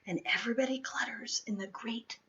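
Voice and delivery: fast whisper; hushed narration. A narrator's reading. Telling of the crowd in the den, a quick whisper. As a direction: whisper so the bear stays asleep.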